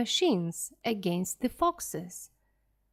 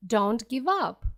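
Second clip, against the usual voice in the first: ordinary delivery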